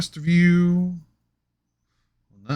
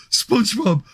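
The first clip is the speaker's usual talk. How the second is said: quicker dopey call, less high; Patrick Star voice; 8 semitones higher than usual. Pretend. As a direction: shout SpongeBob again, brighter.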